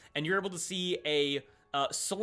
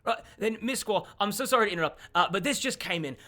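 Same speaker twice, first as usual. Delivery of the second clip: quick, higher apologetic messenger; elven man's voice; 5 semitones higher than usual. Acted. Elven man bringing fresh news to his boss, hurried high voice. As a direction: hurry the apology, pitched up. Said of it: "as he looks and goes".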